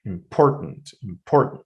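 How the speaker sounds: "important" as im-POR-tant, im and tant weak; stress on POR. Shown as a demonstration. Teacher summing up the stressed part, middle syllable stressed. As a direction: hit only POR in "important". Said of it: "only part that is, uh, stressed is por".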